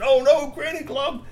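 high, slow cry of alarm. Acted. Trolls watching Granny fall, high alarmed cry. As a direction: gasp out her name in dismay.

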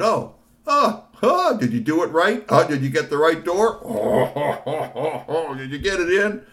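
high, loud jeering taunt with laughter; mocking bully voice. Bully taunting Tony over the door, jeering. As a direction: sneer at him in a squealing high voice.

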